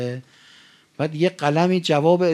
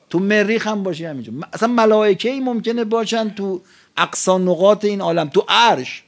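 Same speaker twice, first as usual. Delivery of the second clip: loud, raised-pitch sermon delivery; impassioned preaching; 6 semitones higher, 6 dB louder than usual. Real, not acted. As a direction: preach it out forcefully to the whole hall. Preaches at full force.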